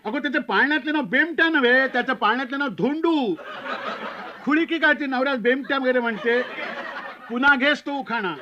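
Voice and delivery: high, querulous reprimand; uncle snapping. Acted. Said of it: "तेवढ्यात तो काका पुन्हा खेकटला".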